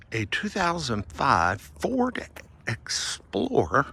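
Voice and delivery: ordinary delivery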